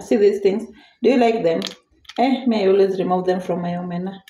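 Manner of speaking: usual speaking voice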